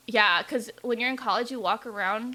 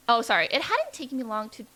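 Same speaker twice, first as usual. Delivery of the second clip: ordinary delivery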